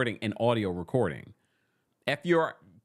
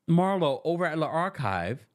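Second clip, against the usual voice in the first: ordinary talk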